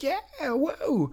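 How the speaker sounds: high-pitched, drawn-out "yeah, whoa" of delight; mock cheer. Dad reacting to learning to draw Goofy, mock cheer. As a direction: re-enact a thrilled little cheer.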